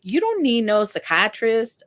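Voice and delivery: badly faked Southern accent, quoting family. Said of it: "That was a horrible accent".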